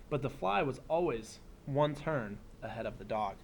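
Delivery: put-on reading to children. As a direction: read it like story time for a class. Reads picture book aloud.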